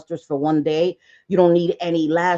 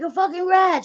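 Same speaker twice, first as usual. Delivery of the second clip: indignant; 11 semitones higher than usual. Retold or imitated. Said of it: "I was like".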